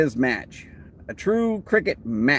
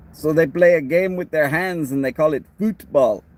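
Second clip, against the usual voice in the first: put-on a rough English accent, louder on them bloody Americans; grumbling Englishman in a half-convincing English accent; self-admittedly Texan-tinged English accent rant; attempted English accent; 3 dB louder than usual. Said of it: "about as close as an English accent as I'm gonna come".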